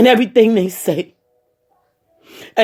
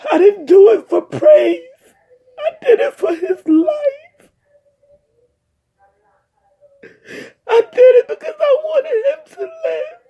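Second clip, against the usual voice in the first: real crying, voice cracking way up as she cries it out; crying shout; 14 semitones higher than usual. Real, not acted. Telling the paramedics why she did CPR, sobbing and shouting.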